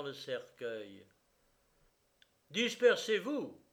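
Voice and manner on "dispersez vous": high, loud command; shouted order. A narrator's reading. The justice of the peace orders the crowd away, shouting. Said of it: "cria le juge de paix".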